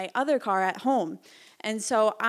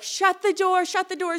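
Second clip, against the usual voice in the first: high, loud yelled order; a cop yelling to shut the door; screaming; 8 semitones higher, 6 dB louder than usual. Retold or imitated. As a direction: bark it at her in a panic.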